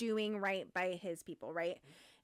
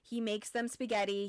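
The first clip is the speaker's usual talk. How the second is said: ordinary talk, nothing marked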